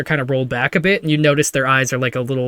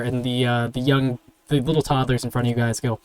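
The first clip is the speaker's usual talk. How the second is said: normal talking voice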